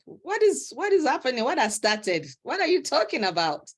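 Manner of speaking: high, startled rapid questions; surprised. Retold or imitated. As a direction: ask it in sudden shock. Retold shocked reply to friend.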